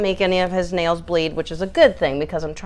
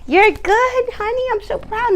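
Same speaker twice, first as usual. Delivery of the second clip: very high sing-song praise; 13 semitones higher than usual. Real, not acted. Owner to little Gus after the trim, very high baby talk.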